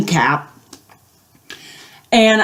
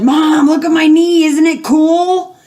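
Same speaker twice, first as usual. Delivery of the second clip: put-on excited kid; 8 semitones higher, 3 dB louder than usual. Showing mom the impaled knee.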